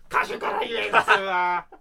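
loud, slow, drawn-out shout; comic yelled objection. Pretend. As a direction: bellow it at the partner in mock outrage. (語尾を伸ばした怒鳴りツッコミ)

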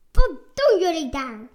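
loud, fast booming challenge from a hidden guard; thundering scary voice. A narrator's reading. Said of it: "dondert zijn enge stem". (donderende enge stem, veel luider en snel)

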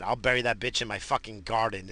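ordinary talk, nothing marked

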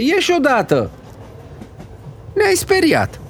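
high, agitated scolding; exasperated order. Acted. Grandmother ordering the frightening boy out, exasperated order. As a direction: order the child out, voice pitched high.

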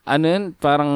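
ordinary talk, nothing marked

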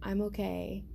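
softly spoken, creepily calm imitation. Showing how creepy he was with the knife, very calm. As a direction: say it softly, as if nothing is wrong. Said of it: "Very calm".

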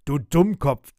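loud, exasperated insult; forceful telling-off; scolding. A narrator's reading. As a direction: snap at a foolish companion. Captain scolds the mate.

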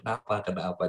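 soft, hushed concern; a quiet, tender why; gentle asking. Retold or imitated. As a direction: ask softly as if comforting him. Comforting a weeping young man.

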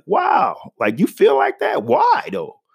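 stunned high-pitched why though; shocked disbelief. Retold or imitated. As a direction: react like you can't believe someone wrote that. Shocked reaction to racist comments.

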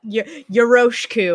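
loud yoroshiku with an American R; American-accented Japanese. Real, not acted. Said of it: "perfect American accent for Japanese".

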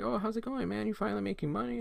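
put-on high friend voice; nosy friend. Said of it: "you see your friend".

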